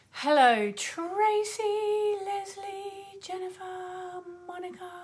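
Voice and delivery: high, held notes on each name; sing-song greeting. Real, not acted. Host greeting live viewers by name, sing-song. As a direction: chant the names in a high, drawn-out lilt.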